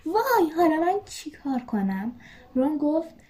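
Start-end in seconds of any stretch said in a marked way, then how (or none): 0.0-2.1 higher, wailing mock panic; a hint of a shriek, stranded and scared; panicked cry. A narrator's reading. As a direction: Hermione lightly wailing, locked out. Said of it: "هرماینی با جیغ و داد گفت".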